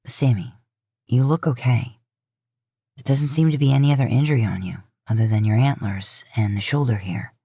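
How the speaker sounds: low, soothing, taking charge of the hurt; older brother coaxing the injured moose to lie down; Dean, reassuring. Acted. Dean reassures after examination.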